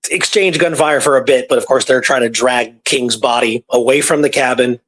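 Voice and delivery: usual speaking voice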